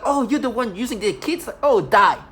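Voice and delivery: imagining a violent person confronting her, loud; mock aggressive threat. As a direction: shout it like someone violent.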